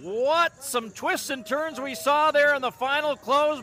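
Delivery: ordinary talk, nothing marked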